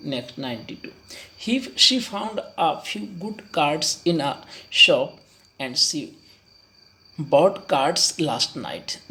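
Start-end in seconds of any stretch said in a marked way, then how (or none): 1.4-9.0 very slow read. Shown as a demonstration. Reading the question.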